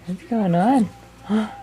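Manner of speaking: high cooing question. Shown as a demonstration. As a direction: lift it up high for the baby. Repeated question to baby.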